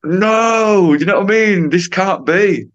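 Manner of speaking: high, loud, drawn-out "no" of disbelief; mock horrified cry. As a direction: wail it in comic dismay. Acting out his dismay at examiner.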